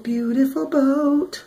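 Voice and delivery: a high, slow singsong repeat; high sing-song. Acted. Admiring the boat, repeated.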